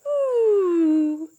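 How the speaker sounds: squealing ooh of delight; a high thrilled ooh. Acted. Teacher to kids, caterpillar tasting food, high delighted ooh. Caterpillar eating, yoga story.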